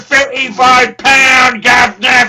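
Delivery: loud ranting Cockney trader voice, swearing at the clients; shouting Cockney character. Acted. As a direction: bellow the price list in a Cockney accent. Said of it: "carried away there with the character".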